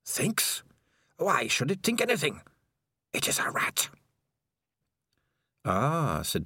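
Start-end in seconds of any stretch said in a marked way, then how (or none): 0.0-3.9 high, thin, incredulous evil voice; taken aback. Acted. Evil rat answering Dangerous Beans, taken aback and high. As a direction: scoff in a strange creature voice. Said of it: "Spider sounded taken aback".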